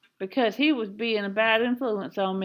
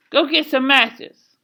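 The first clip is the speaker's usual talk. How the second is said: loud, higher-pitched command; stern mother's order; 5 semitones higher, 6 dB louder than usual. A narrator's reading. Mama turning to Kenneth, loud stern order. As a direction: demand it with force.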